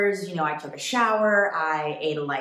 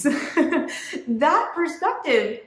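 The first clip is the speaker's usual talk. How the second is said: laughing; 8 semitones higher, much slower than usual. Real, not acted. Laughs mid-sentence.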